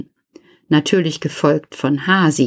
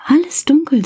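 much higher, emphatic repeat; exclaimed aloud; 11 semitones higher, faster than usual. A narrator's reading. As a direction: burst out with the news. Repeats, now exclaimed to kids. (viel höher, betont ausgerufen)

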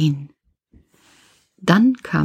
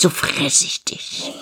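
whispered threat; 4 semitones lower, 5 dB quieter than usual. Acted.

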